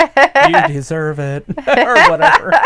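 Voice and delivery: slow, loud, high sneer; mock villain taunt. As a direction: ham up a heel boss. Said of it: "and he's like".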